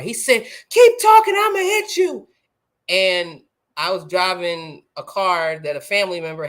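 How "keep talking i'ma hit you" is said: very high, shouted imitation of a furious little boy; angry child's threat. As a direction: yell a threat like an upset five-year-old. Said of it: "he was angry. He was crying".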